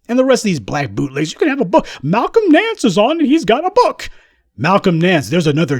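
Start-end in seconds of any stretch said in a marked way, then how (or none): 1.3-4.1 high, excited sing-song mockery; gushing mock promoter, pitched way up; mock book plug. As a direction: hype it up in a silly high-pitched voice. Mimicking hype around pundits' book deals.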